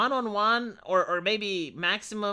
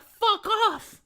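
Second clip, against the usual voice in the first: mock snapping; 5 dB louder than usual. His imagined retort to guests.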